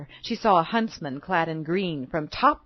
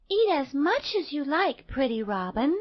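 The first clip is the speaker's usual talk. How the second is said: lightly voiced little girl, starting high; kind little girl; 6 semitones higher than usual. A narrator's reading. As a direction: offer it kindly as a small girl. Girl kindly addresses a robin.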